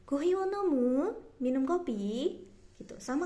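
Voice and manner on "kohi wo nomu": "nomu?" rising at the end; casual question with a rising end; rising question tune. Shown as a demonstration. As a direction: ask it with the pitch lifting on "nomu". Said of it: "dinaikkan nadanya".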